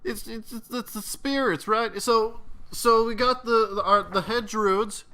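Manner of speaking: high, rushed, nervous explanation; spilling it out to save himself; frightened confession. Acted. Fred babbling his secret to the interrogator, high nervous voice.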